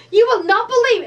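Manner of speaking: high, loud and astonished; yelling to husband. Retold or imitated. Crafter re-enacting telling her husband about the idea, excited yell. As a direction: holler the big news at him. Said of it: "'cause I'm yelling at my husband".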